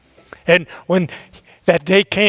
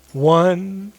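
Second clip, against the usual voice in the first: 1 counting down; 2 semitones higher, much slower than usual. Acted. Mom counts down to flying.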